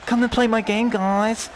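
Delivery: high-pitched mock London accent. Streamer mocking a developer's game pitch, mock London accent. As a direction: put on a high, cartoonish London voice. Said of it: "in his London accent".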